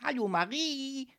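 high, singsong hello; playful spook's welcome, drawn upward; friendly grinning ghost. A narrator's reading. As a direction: greet her with a gleeful grin. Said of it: "Es grinste freundlich und sagte".

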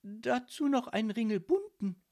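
put-on bewildered girl's voice; irritated disbelief. A narrator's reading. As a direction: remark on it, thoroughly baffled. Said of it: "stotterte Marie irritiert".

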